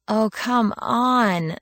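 slow, drawn-out exasperation; fed up, stretching it out; exasperated. Acted. Exasperated complaint.

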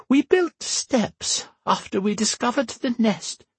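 put-on high old woman, out of breath; puffing old woman climbing stairs. A narrator's reading. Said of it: "her voice sounding out of breath as she climbed".

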